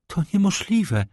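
high, startled cry of disbelief; shocked exclamation. A narrator's reading. Baroness hearing her horses are gone, shocked. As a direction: gasp it out in disbelief. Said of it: "zawołała".

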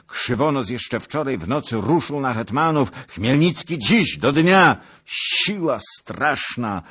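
panicked report. A narrator's reading. Rider describes the land in flames, mounting panic. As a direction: build from urgent news to cries of horror.

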